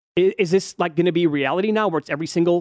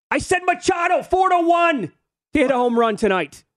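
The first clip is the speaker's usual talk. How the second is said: very high shouted gambler rant; hammed-up shout, easing down at the end; mock irate bettor; 12 semitones higher than usual. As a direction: yell it like a bet gone wrong. Voicing irate bettor in fight.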